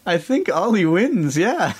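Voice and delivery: ordinary talk, nothing marked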